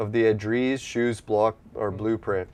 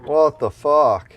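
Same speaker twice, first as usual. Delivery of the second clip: louder, higher, drawn-out disbelief; genuinely thrown, slow and loud; real dismay; 4 semitones higher, 7 dB louder, much slower than usual. Real, not acted. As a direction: stretch it out loud, taken aback. Finds his blueprint missing.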